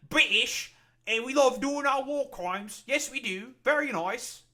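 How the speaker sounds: high, hammed-up British accent, proud and smug; a bad comic British accent bragging about war crimes; mock British accent. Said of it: "That's probably a dog shit fucking accent".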